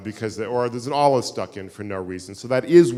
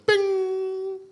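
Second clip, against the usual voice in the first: imitated ring. Stone makes odd ringing sound.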